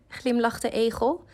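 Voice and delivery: ordinary delivery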